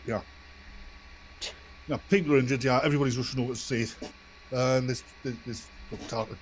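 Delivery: ordinary delivery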